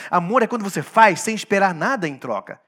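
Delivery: ordinary delivery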